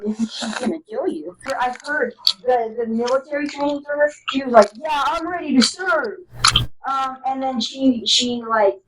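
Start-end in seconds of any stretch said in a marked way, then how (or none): 4.8-6.2 eager recruit. Acted. Taylor quoted at training.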